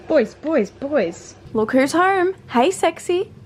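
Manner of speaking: high, bright greeting to the dogs; welcoming someone home. Real, not acted.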